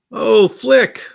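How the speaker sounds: normal talking voice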